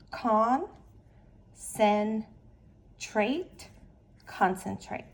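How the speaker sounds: "con", "sen", "trait" each said apart with a pause, then "concentrate" in one go; syllable by syllable. Shown as a demonstration.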